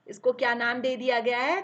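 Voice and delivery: normal talking voice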